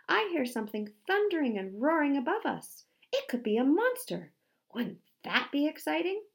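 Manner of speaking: high, loud and thrilled; delighted shout from up ahead; excited shouting. A narrator's reading. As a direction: call back eagerly about a discovery. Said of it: "shouted Dwayne, far in the lead".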